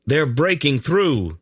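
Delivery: high, slow alarmed cry; urgent yell. A narrator's reading. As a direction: warn her they are breaking through. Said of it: "Gerion yelled".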